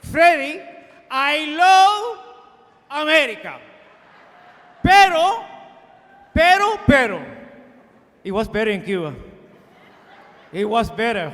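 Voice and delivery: hammed-up old Cuban friend insisting on Cuba; Mister Vieira's Cuban accent. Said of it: "Mister Vieira".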